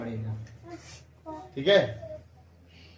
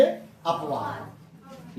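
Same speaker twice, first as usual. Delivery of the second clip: dictating slowly; 5 semitones lower, 5 dB quieter, much slower than usual. Shown as a demonstration. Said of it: "क्या heading डाल देंगे?".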